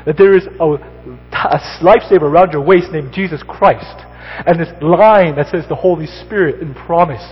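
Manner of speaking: loud, high, ringing preacher's proclamation; full-force preaching. Acted. Pastor urging Christians that Jesus is their lifeline, preached loud. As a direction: preach it out with full conviction to the whole room.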